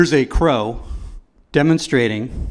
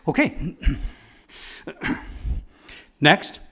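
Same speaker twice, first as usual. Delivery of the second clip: usual speaking voice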